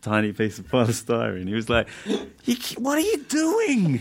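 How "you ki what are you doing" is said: much higher, slow, strained put-on voice; a shrill-sounding comic rant, slowed; mock outrage. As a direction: ham up his shocked fury. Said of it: "he went mental".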